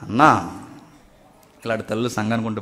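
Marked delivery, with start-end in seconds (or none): none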